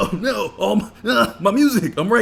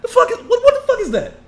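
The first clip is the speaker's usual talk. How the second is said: much higher, louder laughing disbelief; incredulous outburst; 11 dB louder than usual. Retold or imitated. Retelling how he reacted to the boss, loud incredulous laughter. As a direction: shout it in outraged disbelief. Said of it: "I'm like".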